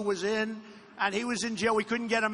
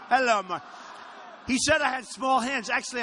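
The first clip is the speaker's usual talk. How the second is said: ordinary delivery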